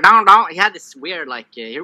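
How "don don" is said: loud, higher dopey grunt; loud mock dopey "Don, don"; dumb soldier voice. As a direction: ape a dim soldier, louder. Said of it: "He was like".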